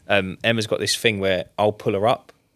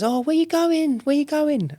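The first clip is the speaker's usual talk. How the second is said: high, sing-song mock plea; lightly camp, mimicking his whine; imitating the flirty fella; slower than usual. Imitates the man at door.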